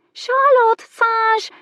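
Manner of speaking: very high, loud squawking bird voice; shrieking parrot. A narrator's reading. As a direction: screech it like a parrot. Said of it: "the parrot squawked indignantly".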